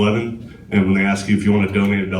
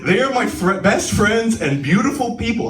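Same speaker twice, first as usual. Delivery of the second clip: higher-pitched mock Trump boast; Trump quote; 11 semitones higher than usual. Quoting Trump's letter again in higher-pitched mock boast. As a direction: savour best friends as Trump would. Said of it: "Then he says".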